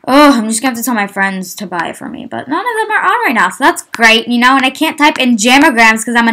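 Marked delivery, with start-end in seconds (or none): none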